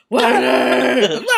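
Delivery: loud, laughing yell of Wendy; mock yell. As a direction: bellow her name through laughter. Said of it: "weird yells".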